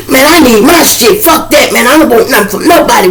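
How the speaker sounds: high shouted refusal, fast at the end; angry refusal. Retold or imitated. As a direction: bark it back, refusing any help. Inmate refusing, yelling in story.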